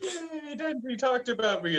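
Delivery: high-pitched, softened squeal of delight; gleeful mock cheer. Playing Power thrilled that Denji mentioned her, gleeful. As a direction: cheer in a high, hammed-up little voice.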